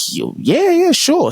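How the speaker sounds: high-pitched, imitated agreement; imitated eager boy. Acting the boy agreeing to prayer.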